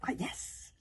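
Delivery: high, sly reply; crafty. A narrator's reading. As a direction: let on that you have a secret. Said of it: "said Kamikaze craftily".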